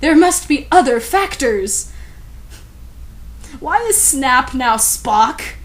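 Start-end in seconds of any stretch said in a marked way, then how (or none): 0.0-1.9 crisp voice. Acted. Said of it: "in a crisp voice".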